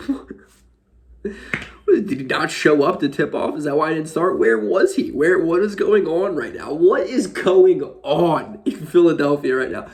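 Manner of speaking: worked-up fan demanding answers; bewildered exasperation. Retold or imitated. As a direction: pile up baffled questions, stretching the last one. Relives bewildered reaction, rapid questions.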